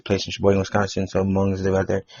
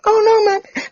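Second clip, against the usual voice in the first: very high, loud imitated cry; mock distressed mom; 6 dB louder, much slower than usual. Imitating the mom as her kid is grabbed, high mock wail. Retold mother as child is grabbed.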